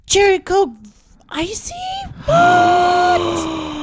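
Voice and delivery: very high, loud thrilled shout; excited squeal. Acted. Shopper spotting Cherry Coke at 7-Eleven, squealing. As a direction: squeal over the drinks.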